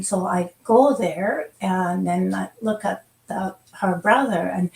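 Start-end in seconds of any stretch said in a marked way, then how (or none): none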